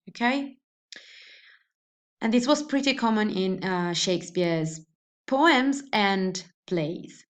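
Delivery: ordinary talk, nothing marked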